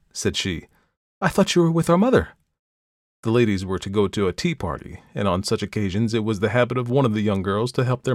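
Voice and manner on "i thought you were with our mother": startled. A narrator's reading. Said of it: "Perdita started".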